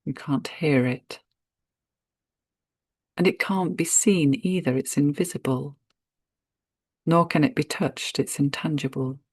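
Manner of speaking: normal talking voice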